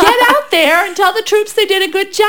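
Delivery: mock Joab. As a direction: bark it like a pushy nephew ordering the king. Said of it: "He's like".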